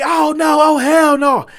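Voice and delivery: high, loud imitated outcry; outraged dismay. Waiter seeing his phone broken in half, outraged dismay. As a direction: wail the protest in mock outrage.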